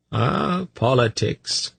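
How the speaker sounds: high, drawn-out sigh of recognition. A narrator's reading. Tzadi sighing over temple politics, high and drawn out. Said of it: "Tzadi sighed".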